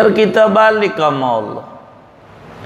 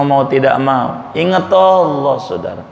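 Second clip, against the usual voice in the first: ordinary delivery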